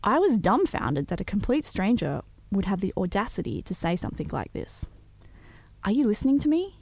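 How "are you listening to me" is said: higher, pushy bark at the narrator. Retold or imitated. Drunk man stepping forward at the singer, barking higher. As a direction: bark it like a drunk picking a fight. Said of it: "he barked".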